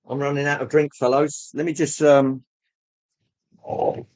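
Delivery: normal talking voice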